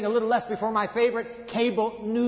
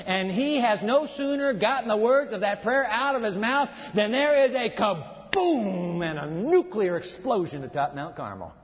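ordinary talk, nothing marked

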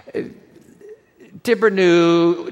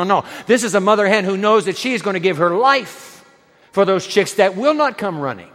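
ordinary talk

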